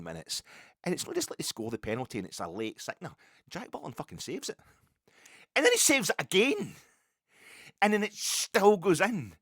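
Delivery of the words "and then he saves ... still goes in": louder and higher, disbelief rising at each twist; exasperated outburst. Real, not acted. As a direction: shout it like the match is slipping away. Reliving a painful football loss.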